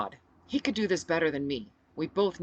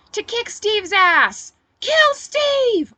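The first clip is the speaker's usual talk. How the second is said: much higher, loud bloodthirsty shriek; 13 dB louder, much slower than usual. Acted. A woman in the crowd shrieks for Steve's death. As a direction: scream for blood. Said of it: "a woman shrieked".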